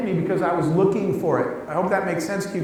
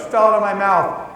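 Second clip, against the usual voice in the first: ordinary talk, nothing marked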